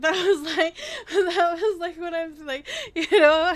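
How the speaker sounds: loud, helpless laughing through words. Real, not acted. Streamer cracking up at own accent, laughing as talking. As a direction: try to explain while cracking up.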